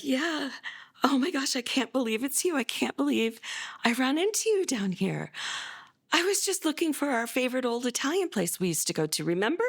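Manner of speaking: mother faking a chance meeting with her son, brightly; overly cheerful surprise. Retold or imitated. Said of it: "I'm like".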